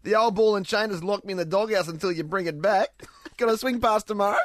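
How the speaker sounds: lightly hammed Australian bloke; mock Aussie bloke. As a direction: ham up an ocker mate through laughs. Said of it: "improvising Aussie comment".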